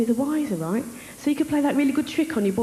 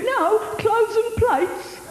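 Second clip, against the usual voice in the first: imitated boyfriend, higher and slow; hapless boyfriend; 10 semitones higher, much slower than usual. Comic voicing her boyfriend correcting her, in a high, slow mimicry. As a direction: spell it out as the clueless boyfriend.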